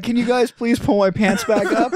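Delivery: high laughing mock plea; pleading. Bill pantsed in public, a high mock plea. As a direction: beg pitifully.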